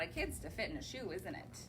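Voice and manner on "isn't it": ordinary delivery, nothing marked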